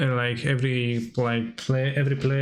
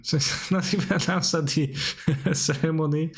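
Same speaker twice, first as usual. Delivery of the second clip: higher voice broken by laughter; laughing; 4 semitones higher than usual. Real, not acted. Streamer reading about the voting blunder, laughing. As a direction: crack up while talking.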